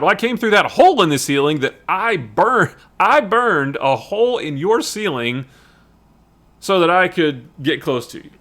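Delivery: put-on eager, high-pitched young agent; over-keen explanation to the boss; imitating a fawning subordinate; Jasper Sitwell. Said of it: "he's kind of a kiss ass".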